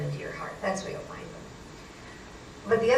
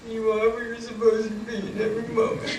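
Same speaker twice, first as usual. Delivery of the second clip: higher put-on reciting voice; grudging mock repetition; 4 semitones higher than usual. Frazzled driver repeating psychic's line on demand, grudging mock repetition. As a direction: parrot the mantra reluctantly, as ordered.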